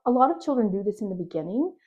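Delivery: usual speaking voice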